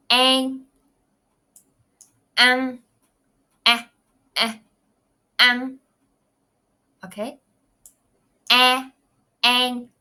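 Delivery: "An," said loud and clear, then "eh"; vowel demo. Shown as a demonstration. Teacher drilling vowel sounds, clear and loud. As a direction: model "An," and "eh" for students.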